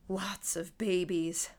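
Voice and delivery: slow, hollow, breathy words. Acted. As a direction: let it drain out hollow and slow. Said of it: "in a hollow, breathy voice".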